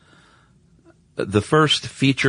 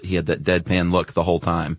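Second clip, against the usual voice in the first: ordinary delivery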